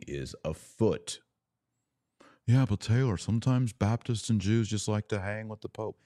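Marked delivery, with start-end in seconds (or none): 2.5-6.0 mock objector. Voicing an imagined objector.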